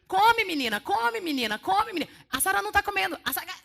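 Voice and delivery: loud, insistent nagging, high and pressing; hammed-up friend pushing food on her; mock pushy urging. Friend nagging a dieter at the pizzeria, loud and insistent.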